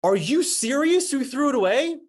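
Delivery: loud, high-pitched disbelief; acted-out horrified yell; aghast outburst. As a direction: shout it in appalled disbelief, pitch way up. Aghast that cards were thrown away.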